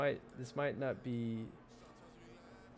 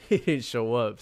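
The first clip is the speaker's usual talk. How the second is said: laughing; 10 dB louder than usual. Real, not acted. Laughing at a bad bet.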